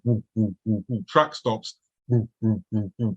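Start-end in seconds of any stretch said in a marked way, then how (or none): 0.0-1.0 thud out a steady beat under your breath; imitated bass thump. Man recalls music playing in his room, imitated bass thump. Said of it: "Bass line". 2.1-3.1 thud out a steady beat under your breath; imitated bass thump. Man recalls music playing in his room, imitated bass thump. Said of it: "Bass line's carrying on".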